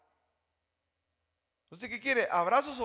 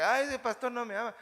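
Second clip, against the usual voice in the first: mock complaint; 5 semitones higher, 4 dB louder than usual. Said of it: "Usted dice".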